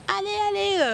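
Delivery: high-pitched cheer, like a trail spectator; imitated cheering. Runner imitating Sunday trail spectators, high cheering call.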